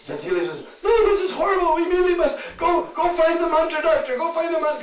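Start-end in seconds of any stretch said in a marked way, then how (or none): 0.8-2.8 panicked mother-in-law. Acted. Jatila panics over Radharani. 2.9-4.2 high, loud, frantic order; panicked urging. Acted. Jatila urges the household to fetch the mantra doctor, high and loud. 4.2-4.8 panicked urging. Acted. Jatila panics over Radharani.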